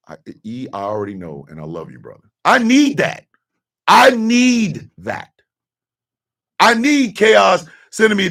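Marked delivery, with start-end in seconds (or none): 0.1-2.3 very soft, low acted reply; hushed, low imitation of own reply; subdued affection. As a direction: murmur it to a close friend, sinking away. Acting his own subdued reply to friend.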